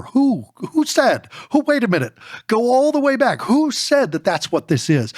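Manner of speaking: high-pitched, insistent questioning; challenging disbelief. Acted. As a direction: call a halt and demand to know who says so. Acting out challenge to assumptions.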